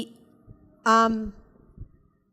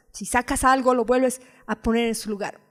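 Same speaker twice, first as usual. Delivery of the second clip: ordinary talk, nothing marked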